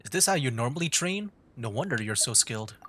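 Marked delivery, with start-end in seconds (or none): none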